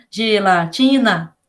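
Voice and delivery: Gelatina stretched slowly, syllable by syllable; teacher spelling out Gelatina slowly; slow syllable demo. Shown as a demonstration. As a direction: draw out Gelatina for the class. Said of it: "se a gente vai falar de gelatina".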